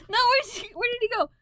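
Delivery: searching wildly for the missing token; frantic search. Real, not acted.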